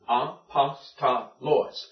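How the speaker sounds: "A, pas, ta, lois" as four separate beats; syllable by syllable. Shown as a demonstration. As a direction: count out "A, pas, ta, lois" one syllable at a time. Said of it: "That's four syllables".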